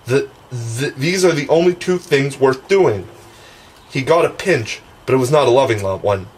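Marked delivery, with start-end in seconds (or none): none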